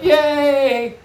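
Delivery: loud, high drawn-out kiddie cheer; excited kids cheering. Retold or imitated. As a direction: imitate children yelling hooray. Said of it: "the kids threw up their hands and went".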